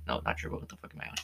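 whispered aside. Real, not acted. Mutters at own mistake.

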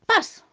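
high, loud "pas!"; short punchy sound effect; sudden impact noise. A narrator's reading. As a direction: pop it out suddenly. Sudden mishap in the story.

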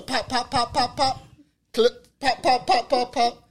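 rapid pop pop gunfire sounds; gunshot imitation. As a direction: mimic gunfire with your mouth. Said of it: "All you hear was".

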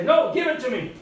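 high, loud robber's demand; insistent demand. Acted. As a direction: act out grabbing the money from her. Robber struggles with the girl.

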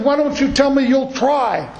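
high-pitched accusing complaint; angry friend calling out the preacher; furious friend. Retold or imitated. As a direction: throw the broken promise back at him. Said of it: "he was just furious".

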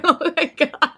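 high retold "oh my God"; playful imitation of panic; mock nervous terror. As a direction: imitate someone wobbling and terrified, amused. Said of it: "and Alan's shaking".

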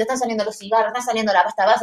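ordinary delivery

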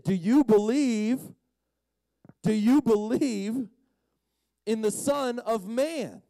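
slow, drawn-out tender asking; Jesus seeking out the healed man, asking him gently; slow earnest question. Acted. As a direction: ask it gently and slowly, letting each word land.

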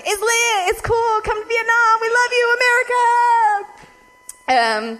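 high, bright, overeager welcome; mock gushing locals; eager cheer. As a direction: hype up the tourists.

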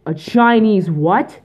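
loud, high, drawn-out disbelief; mock shocked question. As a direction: repeat it back in exaggerated astonishment. Retelling his shocked inside-joke reply.

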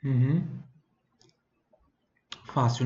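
ordinary talk, nothing marked

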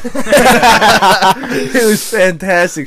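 drawn out laughing reply. Real, not acted. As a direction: laugh through the words slowly. Laughing answer about hike.

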